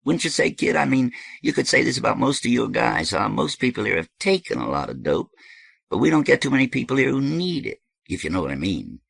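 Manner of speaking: high, emphatic host making his case; high, lively character voice; animated dope host. Acted. Voices Thirteen in story.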